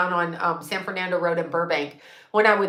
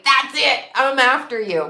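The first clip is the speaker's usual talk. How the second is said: mock fed-up threat; 5 semitones higher, 5 dB louder than usual. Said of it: "And I'm like".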